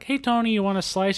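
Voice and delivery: high, cheery Brooklyn shopkeeper; pizza guy voice. Retold or imitated. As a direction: call out like a friendly pizza guy. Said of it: "and they're like".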